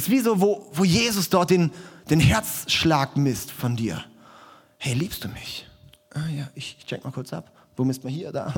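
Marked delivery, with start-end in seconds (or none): 4.8-5.7 much softer, intimate question; Jesus gently asking you, hushed. Retold or imitated. Jesus asks the listener. 6.1-8.5 whispered, fumbling mumble; comic whisper feeling for a heartbeat; whispered aside. Pretend. Joke: checking for a pulse.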